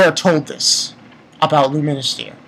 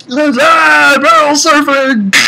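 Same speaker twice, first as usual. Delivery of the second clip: high, louder playful whoop; mock gleeful yell; 7 semitones higher, 4 dB louder, slower than usual. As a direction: imitate someone whooping on a ride. Imitating characters playing on barrels.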